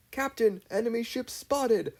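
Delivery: high, urgent call; lookout's shout. A narrator's reading. Arin spotting the enemy ship, shouting. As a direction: call it out from the rail. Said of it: "Arin shouted".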